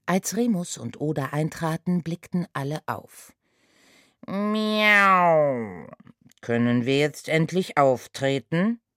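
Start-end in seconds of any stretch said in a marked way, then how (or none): 4.3-5.9 loud, high cat meow. A narrator's reading. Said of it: "fragte der gestiefelte Kater". 6.4-8.8 lightly voiced impatient cat; a hint of a whiny cat; cat character voice. A narrator's reading. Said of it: "fragte der gestiefelte Kater".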